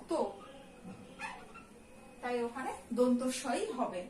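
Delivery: usual speaking voice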